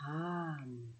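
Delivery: "Ham" drawn out softly, sinking in pitch; mantra on exhale. Shown as a demonstration. Teacher shows the mantra for breathing out, soft sinking "Ham". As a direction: let "Ham" fade like a slow breath out. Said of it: "quand l'air ressort des narines".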